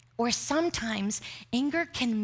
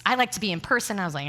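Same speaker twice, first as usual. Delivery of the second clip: ordinary talk, nothing marked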